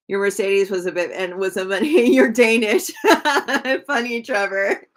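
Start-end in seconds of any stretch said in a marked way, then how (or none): 3.0-3.8 real laugh. Real, not acted. Laughing at viewer's joke.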